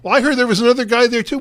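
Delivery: quick, high acted townsperson; eager gossip. Townsperson adds to the healing rumour, eagerly.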